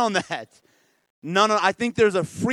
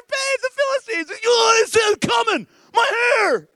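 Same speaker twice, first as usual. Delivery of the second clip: very high falsetto scream; hammed-up shriek of alarm, building louder; Delilah; 6 dB louder than usual. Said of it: "She's like".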